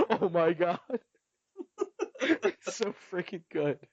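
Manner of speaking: slow words through laughter; laughing. Real, not acted. Laughing over the scene.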